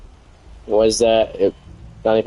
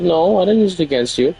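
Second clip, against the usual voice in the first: high mocking voice of the partner; mock the partner's denial in a higher pitch; mocking whiny denial; 8 semitones higher than usual.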